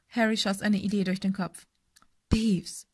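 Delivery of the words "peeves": husky, projected stage whisper; hoarse whisper. A narrator's reading. Harry posing as the Baron to Peeves, hoarse whisper. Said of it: "sagte er heiser flüsternd".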